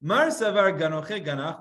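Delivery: ordinary talk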